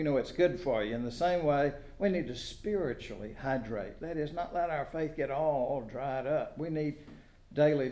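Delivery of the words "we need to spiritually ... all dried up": ordinary delivery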